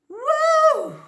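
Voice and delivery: loud, high whoop of joy; excited cheer. Real, not acted. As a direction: cheer like you just won. Cheering mid-lesson after gulps.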